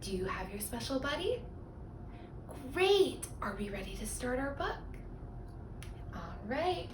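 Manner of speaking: usual speaking voice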